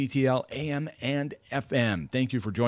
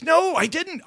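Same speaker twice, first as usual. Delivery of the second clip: much higher, indignant no I didn't; mock indignant denial; 3 dB louder, slower than usual. Dad denying to his family that he said it: high, defensive mock denial. Dad denies using accent.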